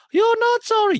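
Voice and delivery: very high, loud caricature; mock accusation. Host acts housewife disputing an apology, high loud scolding. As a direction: throw it back in a piercing mock voice. Acted quarrel between housewives.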